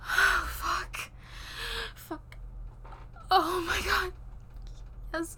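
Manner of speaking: rising breathy moans, loud and intense; breathless moaning. Acted. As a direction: let the pleasure pour out in gasps. Lovers in intimate roleplay scene.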